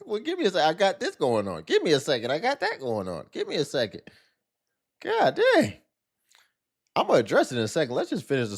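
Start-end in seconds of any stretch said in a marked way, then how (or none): 0.1-4.0 high, whiny mock excuse repeated; mocking imitation. Cohost mocking the guest's repeated excuse, high whiny voice. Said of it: "she done used it, like, five times". 5.0-5.7 high squealed exclamation; exasperated exclamation. Real, not acted. Cohost reacting in disbelief, high squeal.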